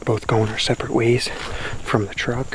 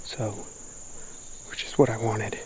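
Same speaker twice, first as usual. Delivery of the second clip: hushed; 11 dB quieter than usual. Real, not acted. Stalking quietly through woods.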